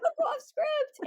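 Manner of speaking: very high, squealed comic protest; hammed-up flustered complaint; mock outrage. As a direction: squeak it through laughter. Retells being thrown off script.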